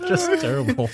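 squeaky laugh on the words. Real, not acted. Host laughing at the listener's terrible puns, high laughing words.

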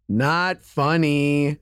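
put-on slow, flat deadpan five-year-old; flat deadpan kid. Son in the back seat, deadpan and slow. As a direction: drag it out like an unimpressed little kid. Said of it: "all I hear from the back seat is".

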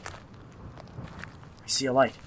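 slow hushed whisper. Acted. Spotting a light in the fog, slow whisper. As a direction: breathe it out slowly, wary.

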